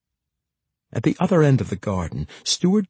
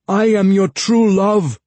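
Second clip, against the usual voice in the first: loud, high desperate yell; 11 semitones higher, 7 dB louder than usual. A narrator's reading. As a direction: yell it across the garden. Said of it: "Jacka yelled".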